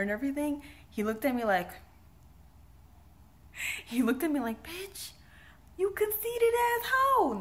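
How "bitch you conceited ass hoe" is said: high-pitched mock disgust, drawn out slowly; offended mock insult. Woman mimicking the guy she brushed off, high mock-offended voice. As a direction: imitate an offended man in a squeaky high put-on voice.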